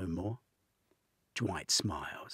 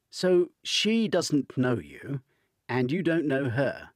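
pointed attack; 8 semitones higher, 10 dB louder than usual. A narrator's reading. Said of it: "Kieran attacks".

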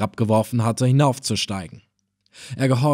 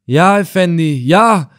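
higher, loud, anxious yes; fearful admission; 6 semitones higher, 7 dB louder than usual. A narrator's reading. Old helmsman admitting to the emir, frightened. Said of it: "gab der Steuermann angstvoll zu".

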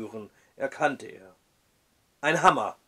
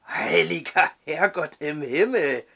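half-awake croak. Acted. The judge, roused from sleep, croaks. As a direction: croak it, freshly woken. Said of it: "krächzte Woodward".

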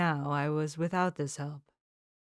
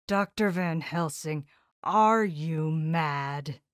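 louder, very slow furious demand; angry outburst; 4 dB louder, slower than usual. Acted. Seward angrily confronting the professor, louder. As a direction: slam it out in anger. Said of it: "sheer anger mastered me".

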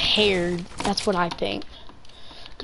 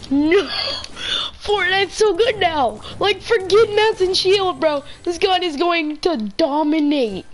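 hyped; 6 semitones higher than usual. Real, not acted. Hyped about the game.

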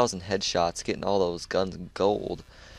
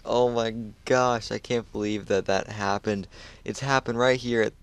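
usual speaking voice

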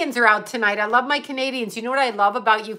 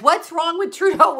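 higher, falling mock-serious "What's wrong with Trudeau?"; mocking serious troll; 7 semitones higher than usual. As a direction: repeat it with a fake straight face. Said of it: "with a serious face".

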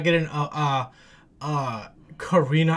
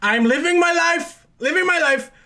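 high-pitched excited gushing; gush, voice pitched right up; thrilled; 9 semitones higher than usual. Real, not acted. Fan got the pairing back to back, thrilled.

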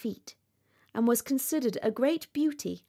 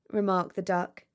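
plain reading voice

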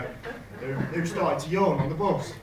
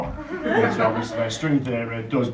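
ordinary talk

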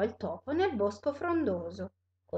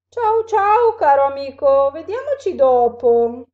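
very loud, high-starting goodbye; hasty alarmed farewell; 4 semitones higher, 13 dB louder than usual. Acted. Owl flees the mouse and monster, alarmed. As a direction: cry out a frightened goodbye.

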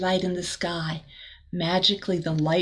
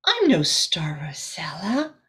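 whispering firefly; 4 semitones lower than usual. Acted. Firefly answering Rosella, in a gentle whisper. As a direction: whisper gently like a small glowing bug. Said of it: "said the firefly".

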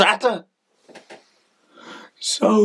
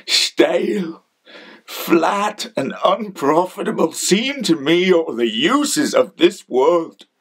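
ordinary delivery

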